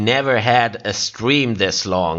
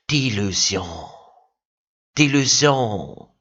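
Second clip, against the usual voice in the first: "Delusion" purred low and slow in French; sultry French accent; 3 semitones lower than usual. Shown as a demonstration. Streamer shows French as seductive, low sultry accent. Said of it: "like you're trying to fuck somebody with your words".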